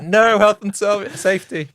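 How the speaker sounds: high, loud mock protest; alarmed onlooker. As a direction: cry out in mock alarm, stretching the words.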